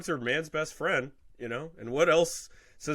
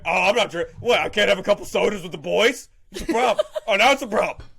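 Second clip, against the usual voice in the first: mock offended drinker, a bit louder; mock defensive drunk; 4 semitones higher, 5 dB louder, faster than usual. Drunk dog waves off the problem, mock defensive. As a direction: scoff like a drunk denying it. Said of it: "classic alcoholic thing".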